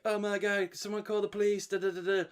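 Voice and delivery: high, alarmed voice; mock panicked bystander. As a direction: play the frantic filmer calling for police. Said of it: "the guy filming's like".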